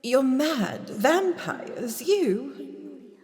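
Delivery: high, stammering, rattled protest; flustered denial. Acted. Caught woman stammering her denial to the doctor. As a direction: stumble over the lie, caught out.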